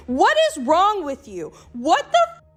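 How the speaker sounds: appalled, rising exasperation; exasperated scolding. Real, not acted. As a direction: pitch leaping up in disbelief. Scolding the woman in the video.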